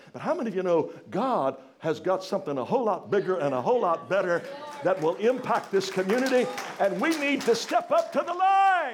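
building to a loud preaching climax; preaching at full force. Acted. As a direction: push it up and out.

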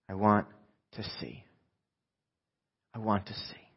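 very soft, low, with pauses; heartfelt plea. Acted. Blind man's heartfelt answer.

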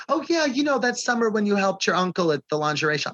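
high breezy fast mention; lightly voiced offhand family member; casual relative. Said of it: "But it's like".

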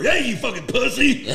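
high mock heckle; heckling taunt. J C heckles over his friend's shoulder, high jeering taunt.

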